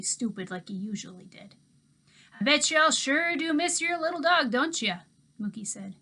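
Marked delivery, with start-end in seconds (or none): 2.4-5.0 put-on easygoing old man talking to kids; Mookie's voice. A narrator's reading.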